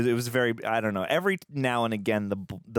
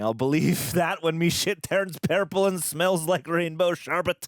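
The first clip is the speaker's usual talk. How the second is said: put-on Scottish accent; 8 semitones higher than usual. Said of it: "in the Scottish accent".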